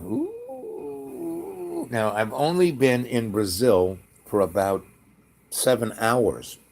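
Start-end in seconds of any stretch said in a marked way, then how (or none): 0.0-1.9 very high, soft ooh of disbelief; wondering ooh. Retold or imitated. Hearing the blind driver story, a high soft ooh. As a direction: marvel half-mockingly. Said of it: "And I'm going".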